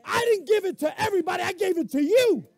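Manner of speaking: loud, high, forceful declaration; God's promise shouted. Acted. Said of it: "God said".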